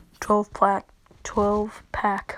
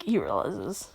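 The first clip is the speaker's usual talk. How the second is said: usual speaking voice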